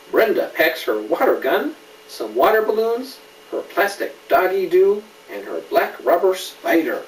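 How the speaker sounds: put-on brighter, building list read to a child; lively picture-book reading; storybook list. A narrator's reading. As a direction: pile up each item with growing energy. Reads picture book aloud.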